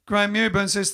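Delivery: ordinary delivery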